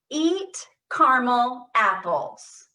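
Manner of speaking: slow, loud, thrown across the room; projected voice. Shown as a demonstration. Therapist projecting to the group, voice thrown across the room. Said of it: "Throw your voice across the room".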